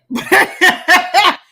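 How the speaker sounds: a loud burst of laughter; hearty laugh. Real, not acted. Host laughs at admitting she never lived there: loud laugh.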